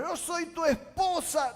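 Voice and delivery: high, loud mock demand. Queen Esther pretending to demand, high and loud. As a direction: throw your rank in his face. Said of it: "La reina no dijo".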